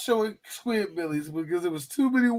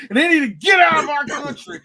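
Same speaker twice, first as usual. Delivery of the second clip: raised, louder mock rant; mock bigoted outrage; 7 semitones higher, 6 dB louder than usual. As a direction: climb into a hammed-up angry shout. Parodying a racist's anti-immigrant rant.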